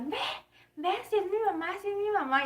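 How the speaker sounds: put-on high-pitched, insistent excited kid; imitated excited daughter. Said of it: "ella bien emocionada porque decía". (hija emocionada imitada, voz aguda)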